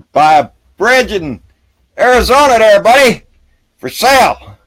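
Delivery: high, loud huckster taunt; mock sales pitch. Taunting Trump believers with a fake bridge sale, mock huckster pitch. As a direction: hawk it like a con man to the suckers.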